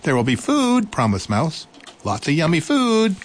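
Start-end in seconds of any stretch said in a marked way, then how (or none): 0.0-0.9 high, bright little mouse voice; eager little mouse. Acted. 2.0-3.2 high, bright little mouse voice; eager little mouse. Acted.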